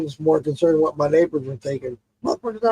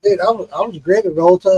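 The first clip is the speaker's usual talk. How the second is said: ordinary talk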